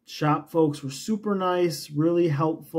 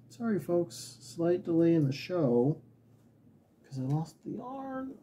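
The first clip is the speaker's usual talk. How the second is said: usual speaking voice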